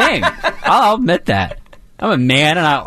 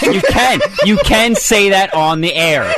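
much higher, animated rebuttal; insistent protest; 8 semitones higher, 3 dB louder than usual. Real, not acted. Producer insisting to host it can go on air, high-pitched protest. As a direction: argue back with a high, insistent voice. Banter over what airs.

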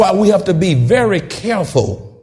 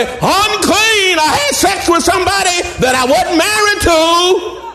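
crying out unclean; 9 semitones higher than usual. Shown as a demonstration. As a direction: cry it out, hand over your lip. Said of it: "you need to say".

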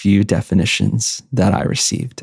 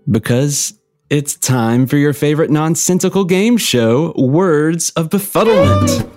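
game show announcer; 6 semitones higher than usual. Acted. Introducing the game show segment.

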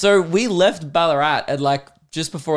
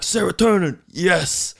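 higher-pitched burst of glee; excited delight; 5 semitones higher than usual. Acted. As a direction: savour a rush of pleasure. Acting out his joy at fun.

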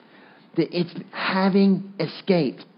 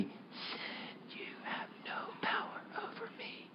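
slow, hushed group whisper; 23 dB quieter, slower than usual. Shown as a demonstration. Preacher whispering together with the congregation, hushed and slow. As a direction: lead the room in whispering it, barely audible. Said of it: "we're gonna whisper it together corporately".

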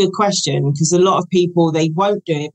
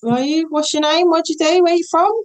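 high, rising puzzled questions; confused client; 11 semitones higher than usual. Retold or imitated. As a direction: play a baffled client.

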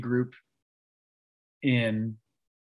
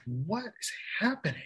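soft, very high squeak of disbelief; bewildered disbelief; 9 semitones higher, 6 dB quieter than usual. Retold or imitated. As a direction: squeak it softly, as if baffled. Retold shock, second time.

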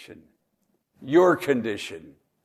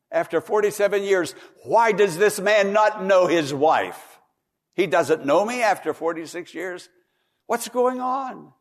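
anguished plea; 7 semitones higher, 7 dB louder than usual. Retold or imitated. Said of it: "she said".

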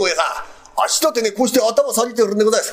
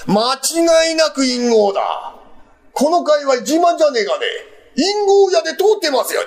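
proud boast; 8 semitones higher than usual. Acted. Landlord proudly declares himself stubborn.

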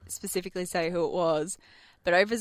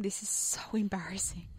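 near-whisper, much softer; hushed aside; 9 dB quieter than usual. Real, not acted. Host confessing a work meeting mishap, hushed aside. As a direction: confide it under your breath. Confiding an embarrassing story.

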